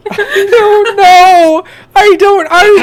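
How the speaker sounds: high, loud, slow laughter through the words; cracking up mid-sentence; real laughing fit; laughing helplessly. Real, not acted.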